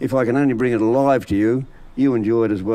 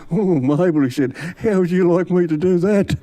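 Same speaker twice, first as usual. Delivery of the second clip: put-on excited old bloke; 6 semitones higher than usual. Excited Dave to Mabel.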